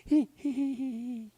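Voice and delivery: high-pitched silly giggle; giggling hee hee. Acted. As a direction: giggle like a lovestruck muskrat. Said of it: "Muskrat goes".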